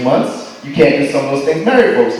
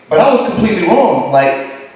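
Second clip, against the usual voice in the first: ordinary delivery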